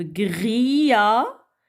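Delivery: Grillage split as grilla - ge; syllable by syllable. Shown as a demonstration. Teacher counting syllables for children, Grillage split in two. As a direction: draw out Grillage and detach the final ge. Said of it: "Deux syllabes".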